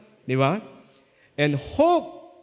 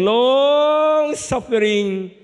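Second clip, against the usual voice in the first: Long suffering drawn out, loud and high; slow emphasis; 7 semitones higher, 6 dB louder, much slower than usual. Real, not acted. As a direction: stretch Long suffering for weight.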